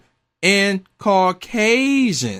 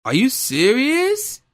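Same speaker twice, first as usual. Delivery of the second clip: incredulous; 5 semitones higher, slower than usual. Real, not acted. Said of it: "disbelief at the coach".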